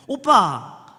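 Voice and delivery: high, loud, curt "오빠"; brusque calling. Shown as a demonstration. Young woman calling church men the hurtful way, brusquely. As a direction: call out "오빠" loudly with no sweetness. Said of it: "늘 이렇게만 불러가지고".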